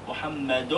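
MUHAMMADUN, slowed onto the final "-dun"; tanween damm. Shown as a demonstration. Teacher demonstrates to the class, slow MUHAMMADUN ending in N. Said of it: "مش أنا بنطق محمد كده".

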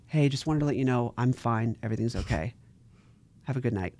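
softly forced calm; whisper-soft fake normal; quiet. Trying to act normal.

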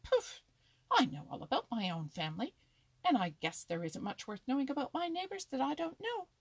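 loud, scornful squirrel boast; boastful exclamation. A narrator's reading. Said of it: "exclaimed Happy Jack".

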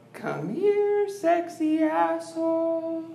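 high, slow come-hither coo to a body part; mock seductive call. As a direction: coax it over in a silly high sweet voice. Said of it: "Do you say, like".

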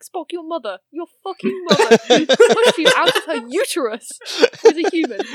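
laughing, shouting at Spock; indignant yell through laughter; mock outrage. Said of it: "And I'm like".

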